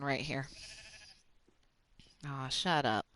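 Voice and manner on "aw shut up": ordinary delivery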